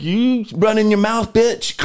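high, slow taunting voice. Retold or imitated. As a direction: sneer it at the victim. Quoting shooter taunting.

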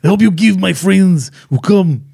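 a hint of Schwarzenegger's Austrian accent; lightly put-on Austrian muscleman voice; Schwarzenegger impression. Friend attempting the German villain as Schwarzenegger, light Austrian accent. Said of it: "pull Arnold Schwarzenegger out of the back of my throat".

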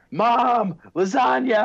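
put-on high, loud kid-style holler; high-pitched demanding shout; son yelling for Mom. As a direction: yell it to Mom in another room. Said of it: "I'll have him yell at my wife".

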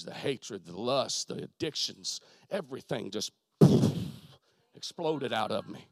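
very low, soft preacher's storytelling; hushed testimony. Real, not acted. Preacher recounting the moment of conversion, hushed and low. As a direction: drop right down into a hushed, reverent telling.

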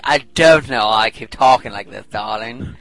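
put-on posh English accent; hammed-up posh voice; posh person. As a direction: talk like a posh darling. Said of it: "an impression I did of a posh person".

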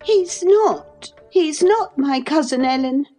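high, tearful insistence with not stressed; cling to the denial in grief; grieving denial. A narrator's reading. Cathy denies the cousin to Ellen, grieving. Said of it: "gathering fresh grief".

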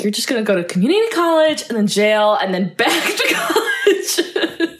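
rising, laughing mock tirade; ranting film mother. Acting out mother's rant from film.

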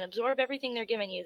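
normal talking voice

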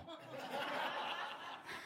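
breathy high giggle, nearly silent; real laugh. Real, not acted. Pastor laughing at his own watch mishap, breathy giggle. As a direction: laugh at yourself, caught off guard.

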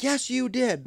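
high mock insistence; mock angry customer. Customer insisting at the booth, mock high whine. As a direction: pitch it up like the pushy customer.

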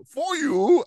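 high-pitched villain impression; mock villain. Quoting a villain's film line.

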